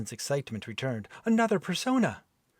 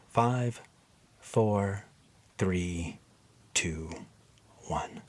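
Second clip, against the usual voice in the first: fading hypnotic countdown; 4 semitones lower, much slower than usual. Acted. Helen counting Dan into a trance, fading countdown. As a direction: count him down into a trance, letting the voice sink. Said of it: "hypnotic countdown fading".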